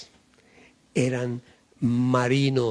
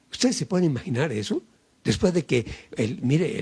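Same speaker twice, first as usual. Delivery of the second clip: ordinary delivery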